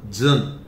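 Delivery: जन with a buzzing zh; American ending. Shown as a demonstration. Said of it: "American में इसका pronunciation है". (जन, "ज़" की गूँजती ध्वनि)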